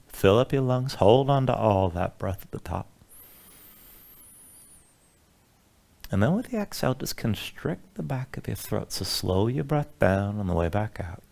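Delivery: guided meditation. Acted. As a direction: ease them into calm breathing. Leads a relaxation breathing sequence.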